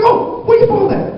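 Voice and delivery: very high outraged squeal; hammed-up indignant mom; shocked mom. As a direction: screech it as a jealous wife. Mom reacts to Nancy news.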